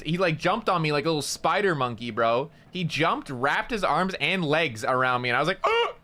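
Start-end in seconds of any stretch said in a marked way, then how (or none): 5.6-5.9 very high, startled mock yelp; re-enacted startled yelp. Storyteller grabbed with arms and legs, startled yelp. Acts out being jumped on.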